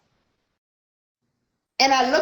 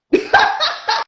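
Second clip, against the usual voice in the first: high, burst of laughter; laughing. Real, not acted. Laughing at her own punchline, high burst. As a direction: break into a laugh.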